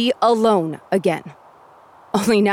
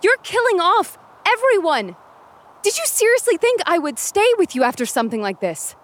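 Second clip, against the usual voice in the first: heated outburst; 13 semitones higher than usual. Acted. Heated quarrel with the horseman.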